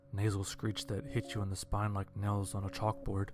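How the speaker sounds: plain reading voice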